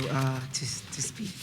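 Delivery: straining to get words out; voice giving out. Real, not acted. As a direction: voice cracking into a whisper. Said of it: "I lost my voice".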